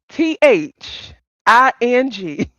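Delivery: slow, separated letters; spelling out. Shown as a demonstration. Teaching Rodney the word, slowly spelled out. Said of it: "repeat after me, baby".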